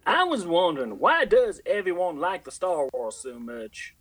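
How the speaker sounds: hammed-up Southern questioner, slow at the end; Brit. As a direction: ask it in a put-on country drawl. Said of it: "I'll be the voice of Brit".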